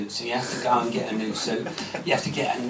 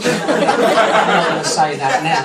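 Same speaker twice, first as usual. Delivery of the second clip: laughing; 2 semitones higher, 6 dB louder than usual. Real, not acted. Laughing after the rude punchline.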